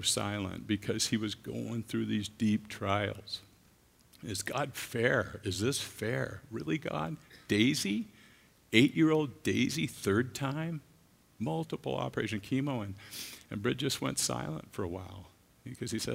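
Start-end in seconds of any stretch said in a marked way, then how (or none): 4.2-12.8 anguished questioning. Retold or imitated. Friend's anguished questions about Daisy.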